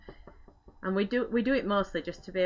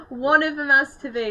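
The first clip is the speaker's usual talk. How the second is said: laughing; 7 semitones higher, 9 dB louder than usual. Real, not acted. Laughing at mouse size joke.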